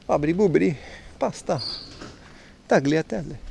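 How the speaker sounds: mock Italian accent, lightly put on. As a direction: toss out Italian words in a playful Italian accent. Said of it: "What accents are okay".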